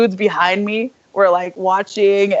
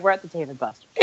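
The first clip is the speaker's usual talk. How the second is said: quiet, knowing storyteller's cliché; mock cliché opener; 4 semitones lower, 9 dB quieter, faster than usual. Shown as a demonstration. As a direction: say it like a tired old opener. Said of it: "Every one of these stories starts off with".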